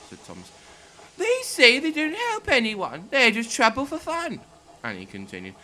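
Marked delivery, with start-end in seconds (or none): 1.2-4.4 much higher, louder and slow coach voice; Annie's high upset voice. Acted. Coach Annie tells Thomas what the trucks said, high and upset. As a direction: pitch it high and loud, upset at the insults.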